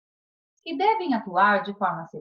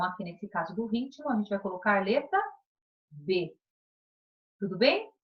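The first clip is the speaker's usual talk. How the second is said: ordinary talk, nothing marked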